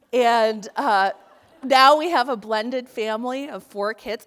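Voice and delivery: normal talking voice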